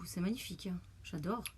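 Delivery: whispering. Real, not acted. Admiring a shimmering eyeshadow.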